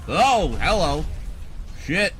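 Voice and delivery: tense, loud nervous reactions; frightened, trying not to get eaten; scared horror game streamer. Real, not acted. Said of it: "that's terrifying".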